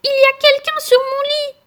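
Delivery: put-on squeaky baby bear, shocked; étonné. A narrator's reading. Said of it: "s'étonna". (petit ours étonné, voix très aiguë)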